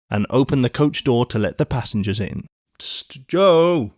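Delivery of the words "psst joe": hissed signal, then a high call; warning cry. A narrator's reading. Coachman warning the guard from his box, urgent call. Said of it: "cried the coachman in a warning voice".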